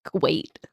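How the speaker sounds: "Great" said playfully as "Gwate"; teasing "Gwate" for "Great"; mimicked W for R. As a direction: echo the slip, "Great" with a W for the R. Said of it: "putting W's instead of R's".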